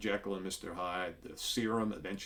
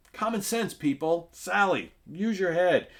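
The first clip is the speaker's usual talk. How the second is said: high, loud mock scolding; playfully exasperated, pitched way up; mock exasperation; 10 semitones higher, 7 dB louder than usual. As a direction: scold the comic-book heroine in raised pitch. Scolding a character's foolish choice.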